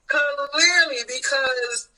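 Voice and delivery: high burst of laughter into the word; laughing. Real, not acted. Cohost laughing at the obvious lie. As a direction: crack up and get the word out.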